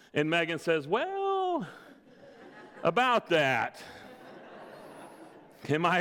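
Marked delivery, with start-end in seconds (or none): 0.9-3.8 Megan's coy hint. Retold or imitated. Wife hinting she is pregnant.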